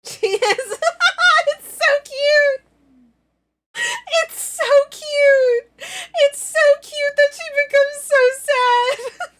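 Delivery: very high, loud squeal of cuteness overload; overwhelmed high-pitched gushing. Real, not acted. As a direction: squeal through laughter, nearly crying with delight. Gushes over cute sad avatar.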